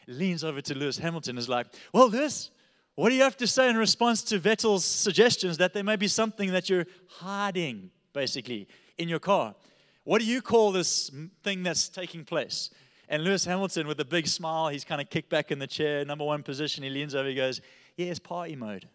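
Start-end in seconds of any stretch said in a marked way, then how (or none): 1.9-12.7 probing interviewer, dragging out key words; gleeful commentator. Acted. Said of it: "The commentator's loving it". 18.0-18.9 put-on laid-back British accent, softly delivered; casual British "party mode" with a swallowed t; smug, easygoing British driver accent; British racing driver accent. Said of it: "Party mode for South Africans".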